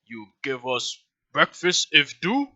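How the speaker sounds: slow, halting simple-minded creature; second lackey creature. Acted. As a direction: ask haltingly like a dim brute. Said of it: "the other one's like".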